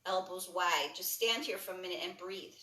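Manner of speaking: calm yoga instructor leading a class; guided yoga cueing. Acted. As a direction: talk them through each stretch gently. Guides a breathing yoga sequence.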